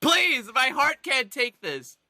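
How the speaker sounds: desperate pleading. Acted. Player begging the frog to show it understands, pleading. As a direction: beg for a sign, leaning on each please. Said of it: "but I'm like".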